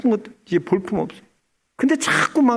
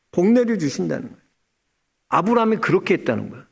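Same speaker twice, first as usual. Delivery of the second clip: ordinary delivery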